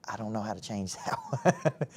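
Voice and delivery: soft amused chuckle through the words; laughing it off. Retold or imitated. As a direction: laugh quietly at the absurdity. Retelling reaction to rejection.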